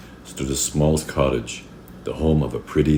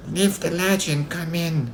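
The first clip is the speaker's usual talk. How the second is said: put-on high, slow old-lady voice; slow high-pitched granny; Grandma. A narrator's reading. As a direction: invite the visitor in as frail Grandma. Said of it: "said Grandma".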